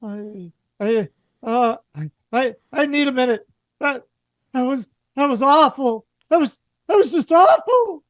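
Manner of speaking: a passenger shaken by something awful; shaken and distraught. Acted. Shaken character behind the door.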